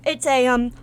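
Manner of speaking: ordinary talk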